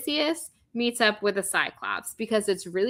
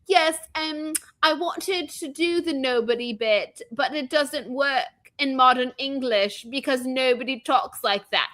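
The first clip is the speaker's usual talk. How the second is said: higher, affected British posh voice mocking a director; snooty British impression; 4 semitones higher than usual. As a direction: sneer through it as a pretentious British filmmaker. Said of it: "was like".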